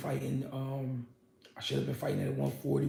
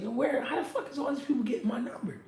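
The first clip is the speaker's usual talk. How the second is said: high, fast, laughing in disbelief; 8 semitones higher, 4 dB quieter, faster than usual. Retold or imitated. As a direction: laugh it out, baffled at all the calls. Baffled by strangers calling his phone.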